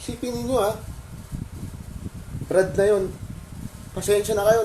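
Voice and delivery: fighting back tears while speaking; choked up. Real, not acted. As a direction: voice rising as tears come. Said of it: "naiyak ako eh".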